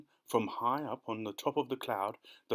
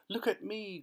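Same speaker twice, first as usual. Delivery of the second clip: much higher gleeful call; excited cry; 9 semitones higher than usual. Acted. Centipede cries out to the others, delighted. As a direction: crow for attention. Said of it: "cried the centipede".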